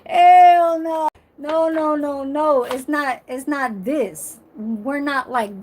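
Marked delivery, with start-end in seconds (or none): 0.1-2.6 loud, high drawn-out hell no, then repeated noes; emphatic refusal. Real, not acted. Rejecting the kiddie gesture, loud high refusal. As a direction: belt out the refusal, easing slightly on the noes.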